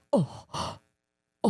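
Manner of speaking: soft breathy "oh" with a gasp; shocked gasp. Retold or imitated. Retelling the shock at friend's answer, soft gasp. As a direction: gasp softly as it dawns on you.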